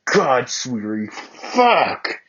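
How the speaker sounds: slow groan rising to a high cry; climaxing. Acted. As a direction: let it break out of him. Bakugo climaxes.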